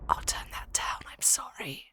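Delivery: hushed, unvoiced whisper; whispered apology. Real, not acted. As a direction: whisper a quick sorry. Music suddenly loud, apologising.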